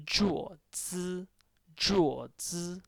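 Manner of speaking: "Zhuo" high with a level O, then "zi"; flat tone. Shown as a demonstration. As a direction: model "Zhuo zi" as a language drill. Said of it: "this is a flat tone on O".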